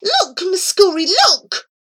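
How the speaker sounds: high, loud urgent plea; begging. A narrator's reading. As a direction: implore a friend to look. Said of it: "begged Abu".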